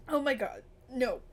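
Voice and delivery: ordinary talk, nothing marked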